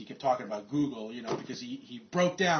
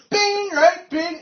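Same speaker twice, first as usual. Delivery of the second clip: screeching boss, much higher and louder, laughing; manic hype; 12 dB louder, much slower than usual. Retold or imitated. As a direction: push everyone to chant it. Boss voice again.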